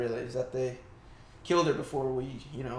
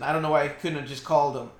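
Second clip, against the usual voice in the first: ordinary talk, nothing marked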